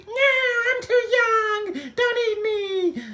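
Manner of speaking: high-pitched cartoon begging; pleading wail. Yodi begging the dragons, high pleading wail.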